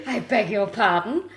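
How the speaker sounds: drawn-out mock indignation; mock affront. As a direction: play someone scandalised by an insult. Playing someone insulted about mum.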